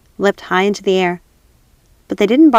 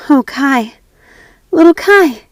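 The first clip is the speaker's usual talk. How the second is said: high, louder yearning; longing cry; 7 semitones higher, 6 dB louder, slower than usual. Acted. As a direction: cry out for Kai. Gerda longing.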